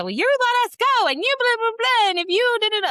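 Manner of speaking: put-on very high, loud screeching teen comeback; mock furious student. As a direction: ham up a shrieking angry girl. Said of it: "she lost her crap right on back to me".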